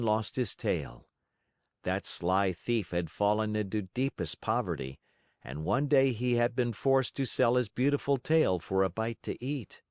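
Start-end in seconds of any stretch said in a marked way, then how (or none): none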